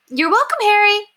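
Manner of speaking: put-on old lady cheerfully getting the boy's name wrong; Miss Peabody voice. A narrator's reading. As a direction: gush a cheery thank-you. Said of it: "said Miss Peabody".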